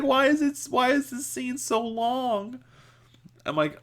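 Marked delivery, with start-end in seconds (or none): 0.0-2.6 very high imitation of her wailing; high-pitched mock distress; mock tearful complaint. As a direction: whine it as a hammed-up sob. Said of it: "She is crying so hard".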